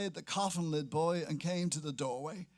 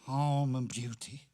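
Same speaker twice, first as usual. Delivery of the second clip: slow, lower, drawn-out baying cry; deep baying; 3 semitones lower, 3 dB louder than usual. Acted. Sailor baying up toward a window, slow and lower. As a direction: call it up at the window like a hound. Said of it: "bayed deeply".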